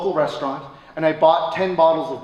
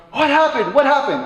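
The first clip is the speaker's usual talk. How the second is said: 1 eager reporter; 10 semitones higher, 7 dB louder than usual. Retold or imitated. News crew rushing at him.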